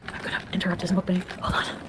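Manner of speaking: whispering. Real, not acted. Whispers in the clip.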